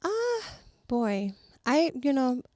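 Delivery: usual speaking voice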